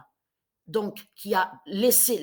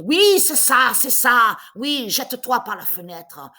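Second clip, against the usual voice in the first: much louder, taunting shout; 2 semitones higher, 11 dB louder, slower than usual. Retold or imitated. Yelling at the neighbour through the wall, loud taunt. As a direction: bellow it so he hears next door. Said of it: "J'ai crié".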